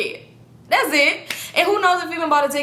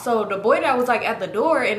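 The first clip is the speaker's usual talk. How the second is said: ordinary talk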